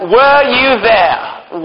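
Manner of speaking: drawn-out, pointed question; slow challenge. Acted. Teacher slowly challenges the kids, drawn-out pointed question. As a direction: stretch each word out slowly to challenge them.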